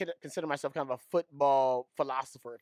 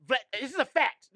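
mock insistence; 8 semitones higher than usual. Real, not acted. Insisting Putin theory is true.

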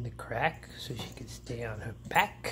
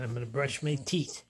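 ordinary talk

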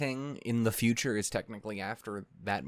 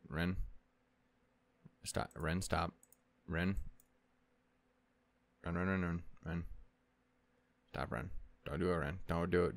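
soft coaxing; 5 semitones lower, 7 dB quieter than usual. Pretend. Soothing an imagined angry Ren.

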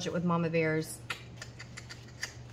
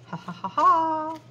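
gleeful laugh; 8 semitones higher, 8 dB louder, slower than usual. Real, not acted. Cheering over the counted cash.

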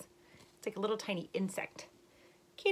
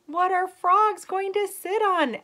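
much higher and louder, eager question; excited question; 12 semitones higher, 11 dB louder, slower than usual. Acted. Dog asking the frog eagerly, excited high question. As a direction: pop the question up with glee. Dog's big question in story.

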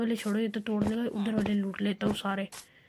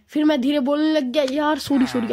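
higher, louder apology; suddenly speaking up; 4 semitones higher, 6 dB louder than usual. Real, not acted. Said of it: "अब पक्का तेज बोलूंगा".

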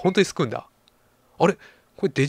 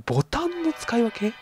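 softer, high puzzled echo of ボタンの; quieter baffled repeat; 10 semitones higher, 5 dB quieter than usual. Real, not acted. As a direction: mutter the doubt again. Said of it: "なんか攻撃カードの使い分けとかでもなく".

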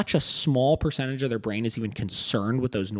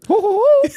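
cartoon laugh; 8 dB louder than usual. Joking about the Mickey voice.

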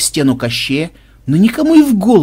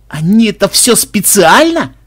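loud, high, drawn-out indignation; offended roar; 7 semitones higher, 6 dB louder than usual. A narrator's reading. Makhmud asking if the players were set up, in an offended roar. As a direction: roar the question slowly, deeply wronged. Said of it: "обиженно взревел Махмуд".

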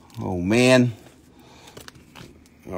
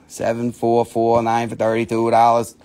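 ordinary talk

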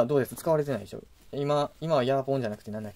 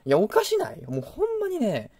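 exasperated outburst; 9 semitones higher, 4 dB louder than usual. Real, not acted. Angry rant about units.

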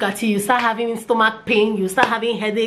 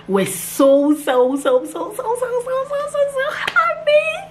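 repeated so climbing much higher to a squeal; giddy so happy; slower than usual. Real, not acted. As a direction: stretch the so's up and up into delight. Joy after pregnancy news.

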